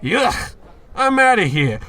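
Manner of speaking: high, loud recoil of disgust; disgusted yelp. Acted. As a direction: jump back grossed out. Disgusted by the gum offer.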